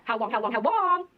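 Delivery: fast, rattled-off repeat; quick chant of the same question; rapid-fire repeat. Acted.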